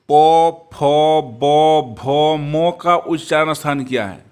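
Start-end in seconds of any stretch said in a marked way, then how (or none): 0.1-2.0 प, फ, ब popped loudly off the lips; lip sounds demo. Shown as a demonstration. Teacher sounds out lip consonants for students, loud. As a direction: spell out प फ ब with firm lips. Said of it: "अब ध्यान दीजिए".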